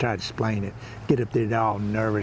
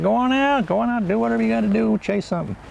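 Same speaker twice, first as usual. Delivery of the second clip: urging the dog; 11 semitones higher, 3 dB louder than usual. Retold or imitated. Dog wants to go out.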